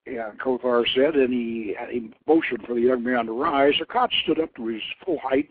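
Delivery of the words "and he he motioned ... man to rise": plain reading voice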